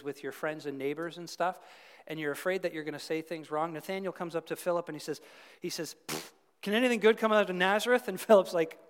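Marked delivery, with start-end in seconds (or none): 6.1-8.1 scoffing "Pfft" then a louder, mocking question; sarcastic scoff. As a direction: sneer at Nazareth with a dismissive pfft. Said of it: "He's being sarcastic".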